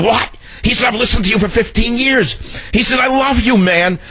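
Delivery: high, excited fan gushing; thrilled fan. Retold or imitated. Stranger gushing to the host about loving his show. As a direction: squeal it like a starstruck listener.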